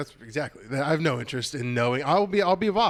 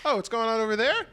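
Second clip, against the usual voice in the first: feigned innocence; 8 semitones higher than usual. Retold or imitated. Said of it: "They're just like".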